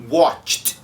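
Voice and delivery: "watched" ending in a crisp tcht; no vowel before the t in "watched". Shown as a demonstration. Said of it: "esse verbo eu pronuncio".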